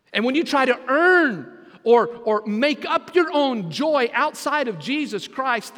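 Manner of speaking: rising pulpit fervor; preaching. Acted. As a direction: drive the warning home to the church. Preacher builds to warning.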